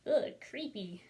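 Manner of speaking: plain reading voice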